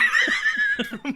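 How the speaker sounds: shrill burst of laughter; high squealing laugh. Real, not acted. Laughing at host's joke.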